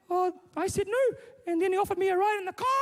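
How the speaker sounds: put-on very high little-girl voice; upset daughter. Daughter telling dad about a man, high upset voice. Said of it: "Mike's daughter comes home from school".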